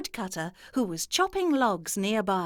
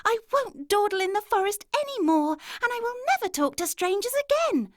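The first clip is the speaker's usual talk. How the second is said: put-on high, louder child's promise; earnest little girl; 13 semitones higher, 5 dB louder than usual. A narrator's reading. Girl promising never to talk to strangers, high and earnest. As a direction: vow it like a relieved small girl.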